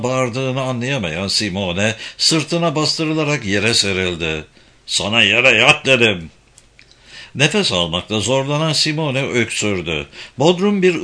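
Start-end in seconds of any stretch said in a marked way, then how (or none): 4.9-6.3 forceful, raised-voice order; angry command. A narrator's reading. Man pins her down and repeats his order, angry command. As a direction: insist with a hard, loud voice.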